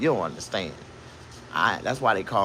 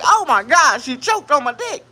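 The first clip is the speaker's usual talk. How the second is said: high, loud falsetto horror; mock horror; 8 dB louder than usual. Comic retelling his shock to the crowd, high horrified shriek. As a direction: shriek it like a panicked squeal.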